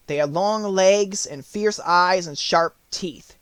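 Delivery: ordinary delivery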